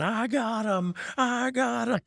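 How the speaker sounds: high-pitched mock scream; high, strained yell without full volume; imitated scream. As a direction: mimic a wrestler screaming in triumph. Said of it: "he's just screaming".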